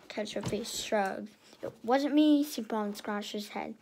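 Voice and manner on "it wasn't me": loud, insistent no; emphatic denial. A narrator's reading. Little sister loudly denying she raided the piggy bank. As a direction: protest your innocence loudly. Sister denies taking money.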